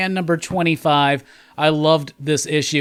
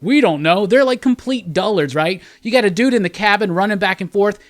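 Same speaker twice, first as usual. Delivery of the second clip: usual speaking voice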